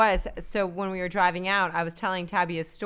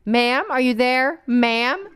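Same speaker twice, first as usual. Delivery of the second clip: slow, louder phone rep; patient call-center agent checking in; customer service voice; 4 semitones higher, 7 dB louder, slower than usual. Retold or imitated. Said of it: "customer representative and they're always like".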